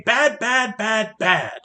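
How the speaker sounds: mild mock of the Lorax's nagging. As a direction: parrot his nagging in scorn. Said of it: "yap, and say".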